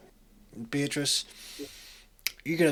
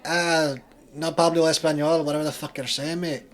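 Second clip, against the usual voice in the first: loud, high Scottish dealer; brash Scottish accent, laughing; thick Scottish accent; 5 semitones higher, 8 dB louder than usual. Acted. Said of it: "He has a thick Scottish accent".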